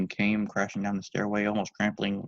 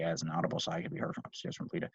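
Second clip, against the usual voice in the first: ordinary delivery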